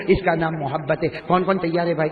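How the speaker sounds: ordinary talk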